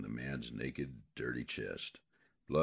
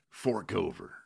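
bandit voice; 5 semitones higher than usual. Acted. Bandit demands money.

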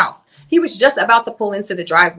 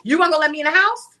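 higher, fast indignant scolding; retold outrage; 8 semitones higher, faster than usual. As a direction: throw it in his face. Retold angry confrontation.

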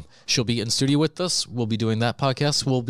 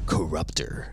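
whisper; 5 semitones lower, slower than usual. Pretend. Dramatic title reveal whisper.

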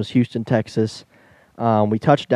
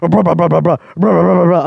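louder, higher voiced rattling babble; imitating turbulence rattle; 4 semitones higher, 6 dB louder than usual. Describing a second rough patch to a friend, loud mock rattling. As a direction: make the plane shake in your voice. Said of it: "it's just like".

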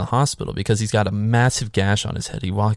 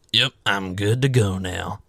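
slow, drawn-out readiness; mock hopped-up readiness; slower than usual. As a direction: stretch it out in a put-on voice. Voicing Lori after the injection.

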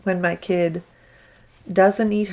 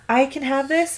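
put-on higher little-kid question; hopeful child; 7 semitones higher than usual. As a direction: imitate a child asking permission. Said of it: "she'll be like".

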